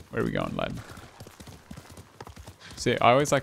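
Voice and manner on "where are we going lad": deep gruff voice. Pretend. Low gruff voice to horse.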